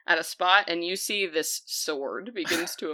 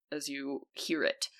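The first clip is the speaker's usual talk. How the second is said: normal talking voice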